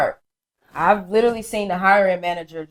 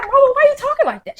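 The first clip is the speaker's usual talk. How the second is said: put-on high, fast child's voice; kid voice; faster than usual. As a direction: pipe up like a puzzled kid. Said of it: "we'd be like".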